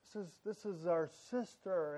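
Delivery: father gently asking his son why he's being unkind; gentle fatherly. Retold or imitated. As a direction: crouch down and coax him softly like a dad. I get down on his level.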